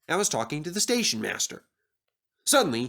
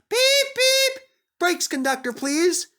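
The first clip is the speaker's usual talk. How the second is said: much higher, louder whistle of a call; whistling Percy; 5 dB louder, slower than usual. Acted. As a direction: toot it out to the conductor. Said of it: "whistled Percy".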